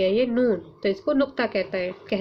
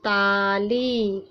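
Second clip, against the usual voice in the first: Tali drawn out very slowly; teacher reading Tali slowly; slow modelled word; much slower than usual. Shown as a demonstration. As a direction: stretch Tali for the class. Teacher reads new word slowly.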